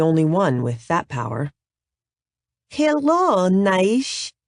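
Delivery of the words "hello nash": high, drawn-out Russian greeting; Russian model says hello in a thick accent. A narrator's reading. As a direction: greet him the Russian way, sultry. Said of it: "in her thick Russian accent".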